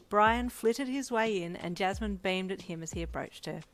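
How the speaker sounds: ordinary delivery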